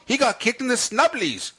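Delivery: high-pitched British voice on "snubblies"; British accent. Retold or imitated. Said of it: "That's kind of British at the end".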